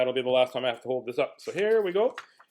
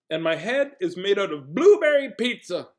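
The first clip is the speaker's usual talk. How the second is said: louder and higher, hammed-up; silly proclamation; 5 semitones higher, 6 dB louder than usual. Reader forced to announce absurd pizza head, loud and silly. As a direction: build to a big goofy finish. Reading absurd line from book.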